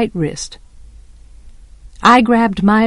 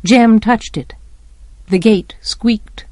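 usual speaking voice

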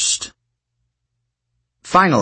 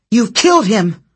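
high, louder accusing shout; 10 semitones higher, 4 dB louder than usual. A narrator's reading. Thalia shouting at Hera that she killed him. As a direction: cry it out in horror at the goddess. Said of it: "Thalia shouted".

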